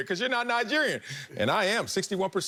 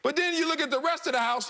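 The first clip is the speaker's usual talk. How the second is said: ordinary talk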